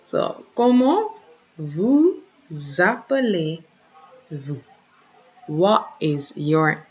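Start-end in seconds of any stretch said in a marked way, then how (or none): 0.5-4.6 slowly, "vous appelez" with a clear z linking; slow liaison demo. Shown as a demonstration. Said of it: "we have that z sound after vous appelez".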